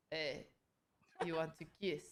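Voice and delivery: a low, lazy mock of the creep; lightly voiced pushy guy, quiet and low; sleazy guy asking. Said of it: "he's like".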